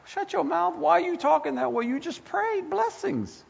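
the Holy Spirit scolds a believer, high and sharp; Holy Spirit's rebuke. Acted. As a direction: rebuke like a stern inner voice. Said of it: "will quicken it and say".

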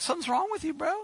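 high, incredulous mock voice; mock family member. Family member dismissing a believer, high and incredulous. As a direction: say it like a doubting cousin.

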